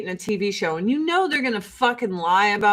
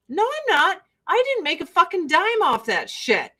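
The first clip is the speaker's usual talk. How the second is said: higher-pitched protest; indignant retort; 7 semitones higher than usual. Retold or imitated. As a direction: push back at the accusers, pitched up. Retorting to accusers calling her ungrateful.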